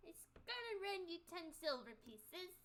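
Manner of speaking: lightly voiced old lady; a hint of an old innkeeper woman; man doing a woman's voice.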